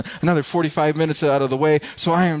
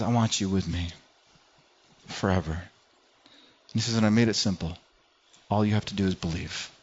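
low tender voice of God; 7 semitones lower, 6 dB quieter than usual. Acted. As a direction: lower and softer, intimate. God's voice, low and soft.

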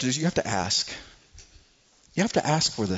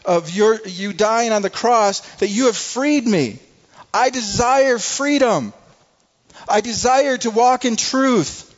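loud, higher, pleading sermon voice; impassioned preaching; 7 semitones higher, 5 dB louder than usual. Acted. Pastor voicing a sinner's plea to Jesus, impassioned preaching. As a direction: preach it with rising fervour. Preacher building to full force.

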